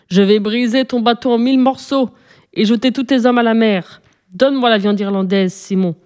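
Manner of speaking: plain reading voice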